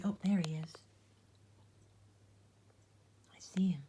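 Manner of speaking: hushed whisper spotting the flea; whisper, don't wake the animals. Real, not acted. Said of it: "whispering, finding the flea".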